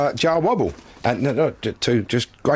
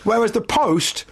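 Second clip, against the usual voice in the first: mocking telling-off; 13 semitones higher than usual. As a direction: squeal it out like a scornful nag. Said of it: "he told me off for that".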